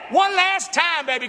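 high, loud rallying call; preacher stirring up the crowd; shouting to the church. Real, not acted. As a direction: rouse the congregation.

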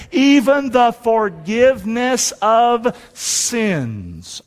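measured, deliberate phrases, slowing to the close; congregational scripture recitation. Real, not acted. Preacher reads the verse with congregation, measured declaration. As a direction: lead a crowd reciting in step.

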